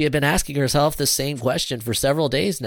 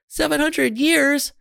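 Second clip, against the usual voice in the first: very high-pitched, shocked outcry; exclaiming in dismay; 4 dB louder than usual. A narrator's reading. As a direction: cry out at terrible news. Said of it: "Sarah exclaimed".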